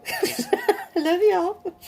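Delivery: real laugh breaking into the words, pitch leaping up. Real, not acted. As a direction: say it through a fond laugh. Laughing affectionate sign-off to viewers.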